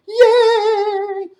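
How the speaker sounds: squeaky high cheer; excited cheer. Acted. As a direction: cheer like a tiny bat. Bat cheers.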